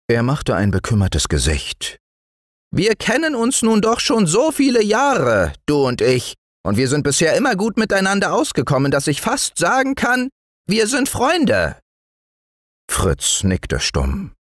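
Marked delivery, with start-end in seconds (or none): 2.7-11.8 very high, earnest gnome; tiny woodland man confiding; friendly little dwarf. Acted. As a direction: plead old friendship in a piping voice. Dwarf figure speaking of friendship.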